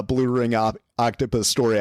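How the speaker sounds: ordinary delivery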